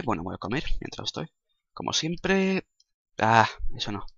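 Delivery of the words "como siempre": high sing-song. Acted. Outro restart.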